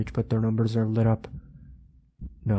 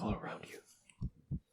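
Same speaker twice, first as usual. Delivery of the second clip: whisper; 15 dB quieter, slower than usual. Acted.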